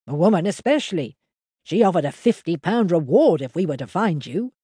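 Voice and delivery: a simple countryman telling what happened; lightly voiced old farmer; Henry the farmer. A narrator's reading. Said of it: "added Henry".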